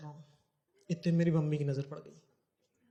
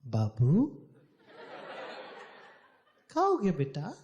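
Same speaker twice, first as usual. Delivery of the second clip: put-on slow, higher coaxing baby talk; mother coaxing her hurt son to tell her, slow and higher; mommy tone; 6 semitones higher than usual. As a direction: coax him like a doting mother. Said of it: "मम्मियों वाली tone use करना".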